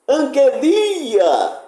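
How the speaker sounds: angeLIa with LI high and drawn out; angelia, long high accent on LI; stress on LI. Shown as a demonstration. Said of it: "quando se altera a sílaba tônica".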